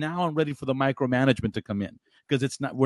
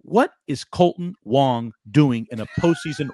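ordinary talk

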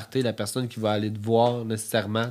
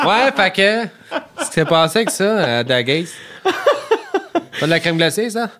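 laughing; 7 semitones higher, 5 dB louder than usual. Real, not acted. Laughing about Drag Race.

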